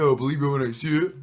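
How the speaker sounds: put-on Brooklyn accent, making fun of it; mock Brooklyn accent. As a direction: do a jokey Brooklyn guy accent. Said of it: "Brooklyn guy with an accent".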